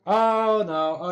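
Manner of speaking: loud, drawn-out oh no; dismayed outburst. Real, not acted. Streamer reacts to the insulin shot in the game, dismayed. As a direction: blurt it out in rising dismay.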